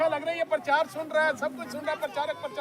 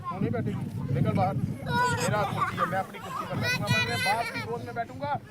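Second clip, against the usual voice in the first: scolding the spirit; 8 semitones higher than usual. Retold or imitated. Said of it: "तब से मैं डांटता हूँ".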